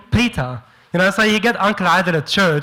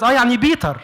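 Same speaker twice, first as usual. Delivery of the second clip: Egyptian uncle voice; 5 semitones higher than usual. Retold or imitated. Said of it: "He goes".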